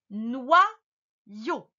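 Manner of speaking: NOYAU split noi... yau; syllable breakdown. Shown as a demonstration. Teacher dictates to the pupil, NOYAU in two pieces. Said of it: "tu écris".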